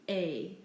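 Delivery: ET as just "ay"; silent T. Shown as a demonstration. Teacher repeating the correct form, silent T. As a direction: say ET as a bare vowel. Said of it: "It's simply".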